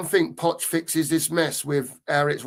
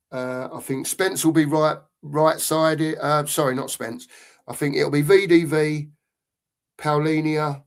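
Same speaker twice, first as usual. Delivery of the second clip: normal talking voice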